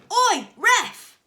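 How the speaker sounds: loud, high call; shout at ref. A narrator's reading. Dad shouting at the referee. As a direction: yell for the ref's attention. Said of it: "he shouted".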